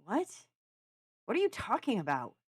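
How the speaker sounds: baffled comeback to an accusation; bewildered. A narrator's reading. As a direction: caught off guard and indignant. Brow furrowed.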